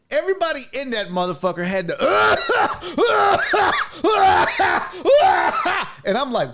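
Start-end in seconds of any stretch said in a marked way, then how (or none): 2.0-6.0 high shrieking burst of laughter; loud laugh. Real, not acted. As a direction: crack up loudly, almost shouting. Laughing mid-story at own disgust.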